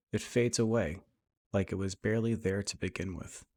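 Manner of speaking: guided meditation. Acted. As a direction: lead them gently through breath and imagery, unhurried.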